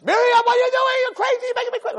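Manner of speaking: very high, frantic scolding scream; screaming parent. Acted. Parent yelling at daughter by name alone, screaming. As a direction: scream the name and tear right into it. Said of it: "But Miriam, it works".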